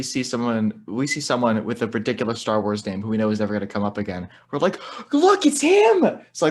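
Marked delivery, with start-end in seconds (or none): 5.1-6.2 slow, high, loud gleeful cry; excited. Retold or imitated. Spotting a minor Star Wars character with his wife, excited cry. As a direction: point out a minor character with delight.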